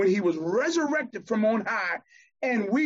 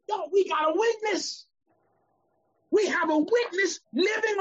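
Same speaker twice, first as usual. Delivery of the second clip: impassioned gospel preacher, voice straining high; preaching at full force; 6 semitones higher than usual. Acted. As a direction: lift the congregation with a climbing preacher's cry.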